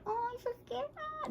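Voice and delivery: high, soft quavery mock fear; mock scared. Groomer showing what not to be like, mock scared. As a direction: squeak it out, pretending to be frightened. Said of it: "Don't be like".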